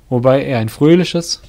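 ordinary delivery, nothing marked

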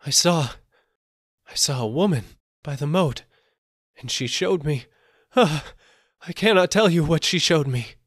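loud, high, panicked telling; terrified. Acted. Terrified merchant blurts out to the seller what he saw. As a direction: blurt it out, too scared to finish. Terrified man describes the faceless woman.

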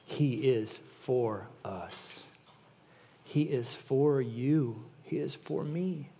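He is for us said slowly, low, each word weighed; slow, low, definite fall on "He is for us"; emphatic declaration. Shown as a demonstration. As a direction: plant "He is for us" as a certainty, not a question. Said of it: "There's no question mark, you guys".

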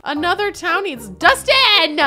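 high, loud cry of recognition; excited exclamation. Real, not acted. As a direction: exclaim it as if spotting a star. Spots a celebrity in the game.

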